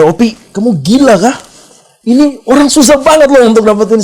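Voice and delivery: imitated boss scolding, high and loud; high-pitched mock outrage; exasperated scolding. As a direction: act out an incredulous boss telling someone off. Voices boss chasing him, scolding.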